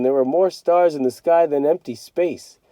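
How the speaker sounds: usual speaking voice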